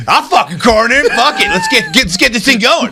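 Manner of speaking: high, hyped corner man; gangster trainer voice. Retold or imitated. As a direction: hype it up like the gold-teeth trainer.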